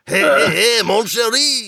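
high, hammed-up French accent; Monty Python French accent. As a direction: call out like a cartoon French seducer. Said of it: "like the Monty Python".

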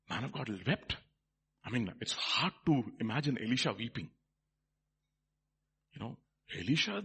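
ordinary talk

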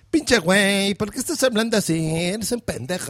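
exaggerated sing-song Mexican accent; mock a Mexican, drawing out the line endings; acento mexicano imitado. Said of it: "siento que lo imitan igual". (acento mexicano caricaturesco)

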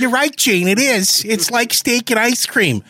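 high-pitched rock star impression; way up high, showy agreement; hammed-up high rocker voice; Paul. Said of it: "That's right, Paul".

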